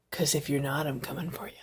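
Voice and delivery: hushed, menacing whisper; creepy whisper. Pretend. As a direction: whisper it like a playful threat. Playful creepy threat to viewers.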